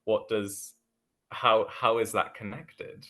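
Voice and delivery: normal talking voice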